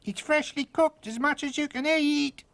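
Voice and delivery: high, loud tiny voice; shouting. A narrator's reading. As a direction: shout the offer up. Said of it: "shouted Tom".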